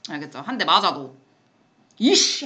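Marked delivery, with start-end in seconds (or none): 2.0-2.5 short, sharp comeback cry; mock angry snap. Teacher acting out fighting back when hit, a mock angry snap. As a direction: bark it as you charge in. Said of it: "하고 달려들어야지".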